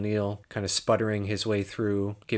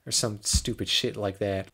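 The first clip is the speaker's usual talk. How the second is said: ordinary delivery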